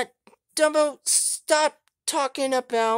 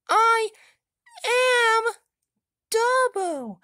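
high, loud proud declaration; lightly voiced babyish toy; childish voice; 12 semitones higher, 6 dB louder, slower than usual. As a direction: announce your name like a toddler. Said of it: "childish accent".

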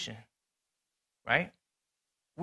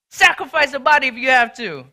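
loud, higher and hard, giving orders; scolding shout; 6 semitones higher, 10 dB louder than usual. Retold or imitated. Brother scolding his teammate on the court, loud angry yell. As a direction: bark orders at a teammate who slacked.

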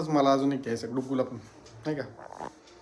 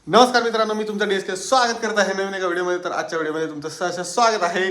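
vlog greeting; 4 semitones higher than usual. Shown as a demonstration. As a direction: launch into the YouTube intro with energy. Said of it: "हे थोडं late बोललो".

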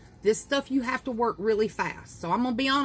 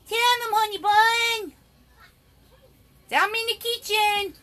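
pet talk; 12 semitones higher, 6 dB louder than usual. Real, not acted. Showing her rabbit to viewers, high cooing pet talk.

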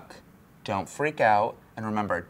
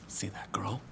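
hushed office gossip; whispered gossip; 7 dB quieter, slower than usual. Real, not acted. Trainer whispering gossip about a coworker across the room. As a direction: whisper so she can't hear you.

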